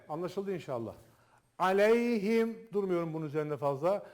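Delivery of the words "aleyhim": slow chanted recitation; Quran recitation. Shown as a demonstration. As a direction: recite the verse slowly, with tajweed. Recites word. (yavaş tecvidli tilavet)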